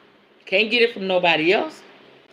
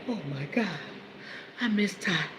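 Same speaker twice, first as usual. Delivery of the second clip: softer, breathy mock lovesick man; imitating her missing man; mock boyfriend; 11 dB quieter than usual. Boyfriend at home pining for her, soft mock. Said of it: "he at home like this".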